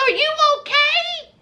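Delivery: high, worried question; concerned Hope. Retold or imitated. Imitating Hope asking Arthur if he's okay, high and worried. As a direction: ask gently but high-pitched with worry.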